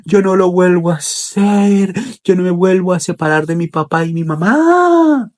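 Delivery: put-on high, wailing little boy; acted crying child. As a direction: wail a tearful promise to behave.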